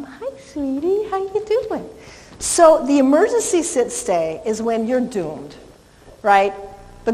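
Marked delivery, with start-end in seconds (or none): 0.0-1.9 high, soft cooing hello; sweet dog greeting. Real, not acted.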